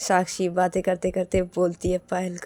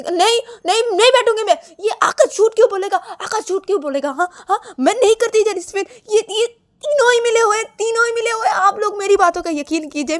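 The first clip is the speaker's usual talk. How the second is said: very high, loud frantic pleading; acted hysterical denial; desperate protest; 13 semitones higher, 8 dB louder than usual. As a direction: refuse loudly, then beg to be believed. Ananya refuses, pleads to be believed.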